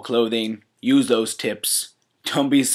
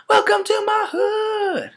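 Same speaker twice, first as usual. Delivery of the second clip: high shout; 4 dB louder, slower than usual. Acted. Shouted catchphrase.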